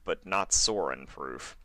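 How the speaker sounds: usual speaking voice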